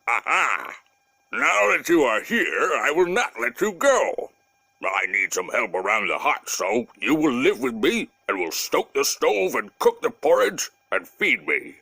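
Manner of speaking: lightly voiced gruff bear, pleased with his find; a storybook bear gloating over his new helper; big bear. Bear telling Masha she must stay, lightly voiced bear.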